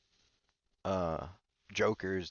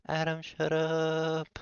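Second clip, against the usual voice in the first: drawn-out shout; 10 semitones higher, 10 dB louder, much slower than usual. Acted. Finally silencing Adam, drawn-out shout. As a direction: shout it and stretch the last word.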